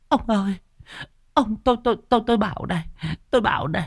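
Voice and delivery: high, trembling stammer, pleading; frightened wife stammering, begging her son to shut the window; stammering terror. Acted. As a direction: beg your husband and son, stuttering with fear. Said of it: "lắp bắp".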